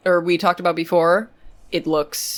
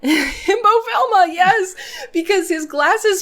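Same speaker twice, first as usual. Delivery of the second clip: laughing, high and slow; 13 semitones higher, slower than usual. Real, not acted. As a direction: talk through giggles.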